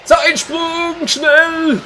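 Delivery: very high, hurried-sounding call; urgent high shout. Pretend. Gamer ordering a time jump from the editor, high urgent shout.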